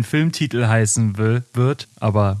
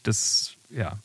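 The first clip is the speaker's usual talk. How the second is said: trailing off; 8 dB quieter than usual. Real, not acted. Can't name the similar song.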